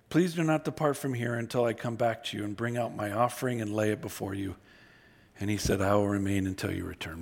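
low, measured Bible reading voice; scripture reading. Acted. Preacher reading Gideon's story aloud to the congregation, low and measured.

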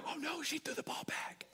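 high, hushed "oh no" aside; soft unvoiced whisper, acted; mock dismayed whisper. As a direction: whisper it like a pretend horrified onlooker. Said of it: "You wouldn't go".

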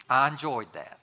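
lightly put-on Southern compliment; Southern accent. Churchgoer shaking the preacher's hand, Southern accent. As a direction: imitate polite Southern church folk. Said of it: "a little Southern accent".

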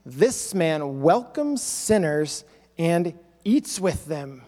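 low, slow sneer fading to a mutter; scornful complaining. Acted. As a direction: sneer it out like a disgusted scribe. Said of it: "Pharisees and scribes were complaining".

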